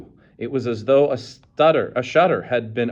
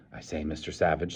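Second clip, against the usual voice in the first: lower, much softer placating; propitiating; 6 semitones lower, 8 dB quieter than usual. A narrator's reading. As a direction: soothe a dangerous man.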